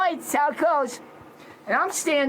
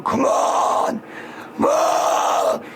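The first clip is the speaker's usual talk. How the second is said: shouted challenge; much slower than usual. Retold or imitated. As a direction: bark it out, daring them to fight. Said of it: "but I'm going".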